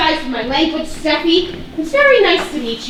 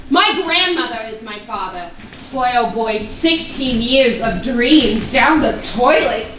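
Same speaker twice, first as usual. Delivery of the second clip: ordinary talk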